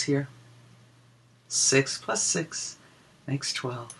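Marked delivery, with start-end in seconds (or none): none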